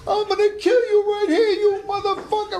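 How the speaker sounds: high, loud imitated ringside threat; mob threat. Mobster at ringside threatens the fighter for winning, furious yell.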